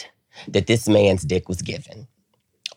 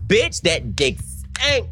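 high, drawn-out squeal; slow high-pitched comic disgust; mock outrage; 12 semitones higher, much slower than usual. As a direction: squeal the punchline, stretching each word. Comic punchline after escaping.

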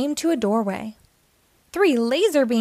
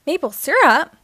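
high, louder puzzled query; surprised question; 5 semitones higher, 6 dB louder than usual. A narrator's reading. Pablo and Uniqua question their friend's maple syrup, surprised. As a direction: question it in mild disbelief.

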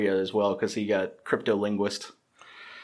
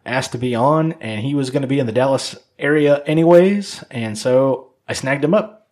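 lively, louder show-host welcome; podcast intro; 2 semitones higher, 5 dB louder than usual. Acted. Host introducing a guest to listeners, upbeat announcer delivery. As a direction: punch up the episode introduction.

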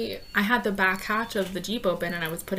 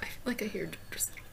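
whispering; 12 dB quieter, faster than usual. Real, not acted. Listening for kids nearby.

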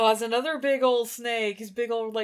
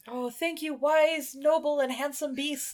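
higher-pitched, humble flattery; fawning Mowgli; 4 semitones higher than usual. Acted. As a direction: thank the beast with mock reverence. Mowgli thanks Kaa with flattery.

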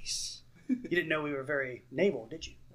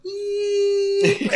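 squeal; 6 dB louder than usual. Retold or imitated. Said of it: "imagine Patrick in the studio going".

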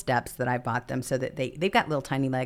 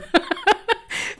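high burst of laughter; 4 dB louder than usual. Real, not acted. Laughing at dog's gift.

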